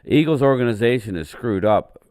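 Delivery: ordinary delivery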